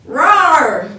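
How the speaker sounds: a big loud bear's roar. Acted. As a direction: roar like an angry bear. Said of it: "Old Bear roared".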